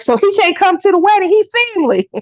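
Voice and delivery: quick, high-pitched outraged pleading; incredulous protest. Retold or imitated. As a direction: argue he's family after all. Retold own reaction about wedding.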